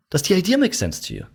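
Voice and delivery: high, sincere curious question; curious tonality. Shown as a demonstration. Salesman asking a prospect with curious tonality. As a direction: ask like you really want to know. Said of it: "this tonality of".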